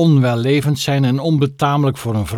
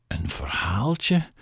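soft, slow whispered question; 3 semitones lower, 8 dB quieter, slower than usual. Acted. Chloe whispers to the tramp at night. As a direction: breathe it in disbelief.

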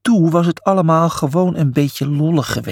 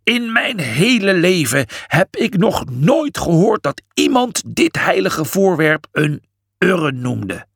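high, indignant tirade; trembling with rage; 4 semitones higher than usual. A narrator's reading. Imhotep pointing at Julius, outraged. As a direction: build outrage at moderate volume. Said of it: "Trillend van woede".

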